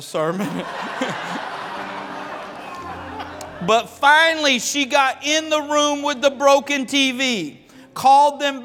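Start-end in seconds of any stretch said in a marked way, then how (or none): 0.5-3.6 breathy laugh. Real, not acted. Laughs at his own story.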